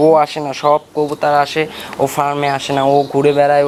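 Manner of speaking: ordinary talk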